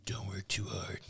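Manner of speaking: hushed unvoiced whisper. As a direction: breathe it out as a whisper. Repeated as a whisper.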